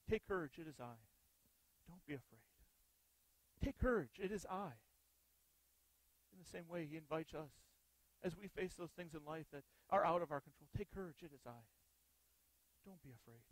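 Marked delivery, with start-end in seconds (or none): 0.1-4.7 reassuring. Acted. 10.8-13.4 soft, reassuring hush; compassionate voice. Acted. Jesus softly comforting us as we face life's fears. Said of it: "with that compassionate voice".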